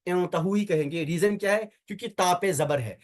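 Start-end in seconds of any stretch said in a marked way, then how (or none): 0.1-0.5 इंतहू begun with "in"; initial short i. Shown as a demonstration. Teacher gives students the correct reading, इंतहू with initial "i". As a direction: say इंतहू with an "i" as first vowel. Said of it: "यहाँ पे क्या कहेंगे".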